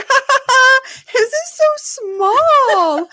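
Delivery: high, drawn-out kid mockery; imitated teasing little boy. Parent mimics small son laughing at baby brother, high gleeful teasing. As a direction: mimic a toddler crowing with mock laughter.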